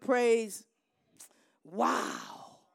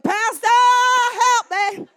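calling out; 8 semitones higher, 6 dB louder than usual. Retold or imitated. Retold cry for help in fight.

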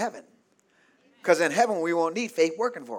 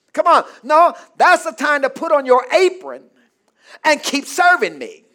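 loud, raised voice of a demanding master; full-force preaching; 8 semitones higher, 11 dB louder than usual. Acted.

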